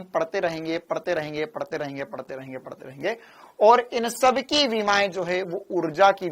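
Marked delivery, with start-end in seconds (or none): 0.0-3.2 fading away. Acted. Fading repetition.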